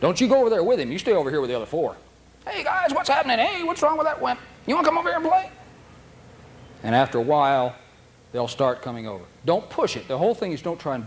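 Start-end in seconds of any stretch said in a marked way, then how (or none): 2.5-5.5 very high, friendly doggy voice; playful dogs. Pretend. Pack calling the timid dog over, high and playful. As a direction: chirp like happy dogs. Other dogs inviting the wimp.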